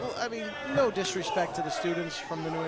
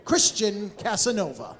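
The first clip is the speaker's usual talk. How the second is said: booming winner announcement, louder; ring announcer; 6 semitones higher, 7 dB louder than usual. Real, not acted. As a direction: announce the champion to the crowd.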